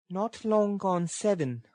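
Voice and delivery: put-on lifted girlish voice for Nancy; girl's voice. A narrator's reading. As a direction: answer high and unhurried as the girl. Girl answers Sikes in story.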